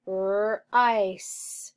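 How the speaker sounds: rice drawn out sound by sound; stretched sounding-out. Shown as a demonstration. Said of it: "read, rice".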